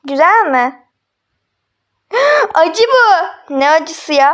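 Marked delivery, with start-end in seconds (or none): 2.1-3.6 shocked gasp. Acted. Ate spicy fruit, gasps.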